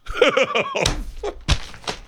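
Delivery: high-pitched burst of laughter; helpless high laugh. Acted. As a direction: crack up, trailing away. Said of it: "That's why I'm laughing".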